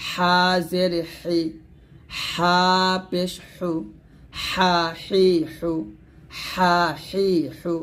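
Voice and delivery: slow হা, হি, হু with thin squeezed air; pressed breathy Ha. Shown as a demonstration. Teacher drilling Ha vowels, slow pressed breathy sound. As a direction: draw out হা, হি, হু, forcing air through mid-throat. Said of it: "এরকম".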